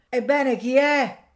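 high, loud and slow; impatient shout. A narrator's reading. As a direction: bellow it at the gate a second time. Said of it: "gridò il mugnaio".

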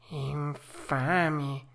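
a hollow show of outrage; fox's mock outrage. A narrator's reading. Fox feigning disgust at the robbers. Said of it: "disse la volpe".

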